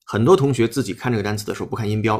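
ordinary delivery

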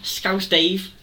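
says his own name in Scouse; Scouse accent. Acted. Halfling introduces himself to guard, Scouse accent. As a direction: Liverpool accent, name tossed out proudly. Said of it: "pick a fucking accent then".

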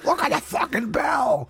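very high mock crowd yell; squeal-shout like one of the crowd; excited townsfolk shout. Co-host joins the bell-crazed townsfolk, high excited shout.

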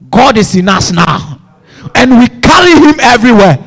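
loud, driving sermon voice; full-force preaching. Acted. Preacher rallying the congregation, loud and driving. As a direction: shout the promise to the church.

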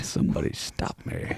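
breathy whisper, barely there; whispered plea. Pretend. Co-host whispering a mock plea to be stopped, hushed. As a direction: whisper it like a guilty secret.

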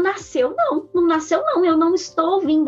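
high-pitched, disbelieving "Nasceu?"; alarmed disbelief. Retold or imitated. Mother hears the doctor and panics, alarmed disbelief. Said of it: "Aí eu".

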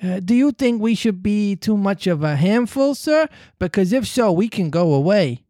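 put-on high timid boy asking, sir rising; lightly voiced boy, anxious and proud; proud twin's plea. A narrator's reading. Proud twin asking the father if they are too many, timid. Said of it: "he asked, flushing".

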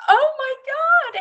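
slow, loud, very high amazement; stunned. Retold or imitated. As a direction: gasp it out together in shock. Both stunned by the matching car color.

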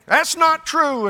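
high, loud, slow protest; mock indignant denial. Preacher plays the husband protesting, high, loud and slow. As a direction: huff it out like an offended man. Husband's defiant replies in quarrel.

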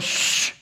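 long, sharp hush; shushing. Retold or imitated. Frozen rider silencing the kid, long shush. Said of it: "I went".

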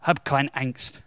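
low, soft, unruffled voice; quietly soothe, as if nothing is wrong; calm reassurance. Retold or imitated. Elias to his servant facing an army, softly reassuring. Prophet calms the panicking servant.

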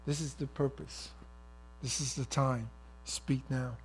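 soft, low divine voice; hushed command from God; the Lord's prompting. Acted. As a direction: instruct softly and low, phrase by phrase. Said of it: "the Lord says".

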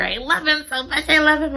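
high-pitched, adoring rush; excited gushing. Real, not acted. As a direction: gush helplessly over a cute pet. Gushing over the cat.

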